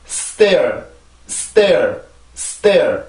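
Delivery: "stair" drawn out with a falling tone; model pronunciation. Shown as a demonstration. English teacher models a word for learners to repeat, model pronunciation. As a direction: say "stair" long, gliding down into the r. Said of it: "그렇게 해서 요 단어를 발음을 해주세요".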